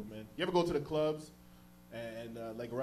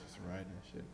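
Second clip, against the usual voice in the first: ordinary talk, nothing marked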